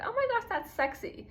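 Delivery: mock swooning. Speaker mimics people admiring a British accent, mock swooning. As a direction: imitate an admirer gushing in a high voice.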